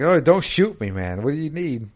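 scared plea. Retold or imitated. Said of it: "He's like".

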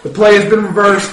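projected official announcement voice; referee announcement. Acted. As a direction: proclaim it like a stadium ref. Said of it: "he turns on his little microphone".

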